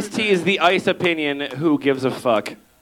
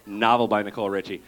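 normal talking voice

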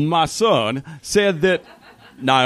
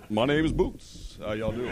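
man's voice; 3 semitones lower, 9 dB quieter than usual. Pretend. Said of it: "y'all know how my lady voice sounds".

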